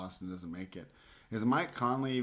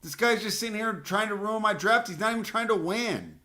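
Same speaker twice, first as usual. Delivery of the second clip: roaring with real rage, much higher and louder; furious shout; 13 semitones higher, 11 dB louder than usual. Real, not acted. Drafter yelling at the rival drafter who sniped his player, furious shout. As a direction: blow up at the rival who stole your pick. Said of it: "I'm so pissed right now".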